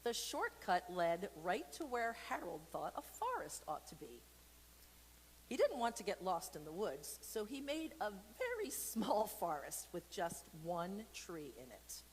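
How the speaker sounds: put-on storybook read-aloud for kids; reading to children. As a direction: tell it like a bedtime story. Reads picture book aloud.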